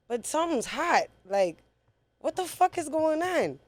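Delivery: re-enacted bewilderment, pitched well up; retold alarmed confusion. Man reliving the moment he felt the bullet, alarmed confusion.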